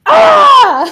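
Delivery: sudden high loud yelp; startled yelp. Real, not acted. Yelping when the cute guy catches her eye. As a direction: yelp out in embarrassed shock.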